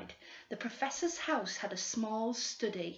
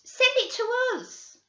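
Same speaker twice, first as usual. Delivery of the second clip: greedy schoolgirl; 8 dB louder than usual. Acted. Girl bargaining with the detectives, high and wheedling. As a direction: bargain sweetly for the reward. Girls voiced by narrator.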